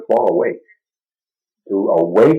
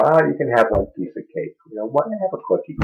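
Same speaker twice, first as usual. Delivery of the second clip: lightly voiced tempting inner voice, higher and softer; a hint of a small coaxing voice, pitched up; little inner voice; 6 semitones higher, 4 dB quieter, faster than usual. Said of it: "That little voice inside that says".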